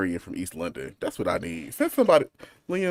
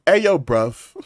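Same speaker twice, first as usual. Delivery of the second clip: mock London; 3 semitones higher, 5 dB louder, slower than usual. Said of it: "I love a good bruv".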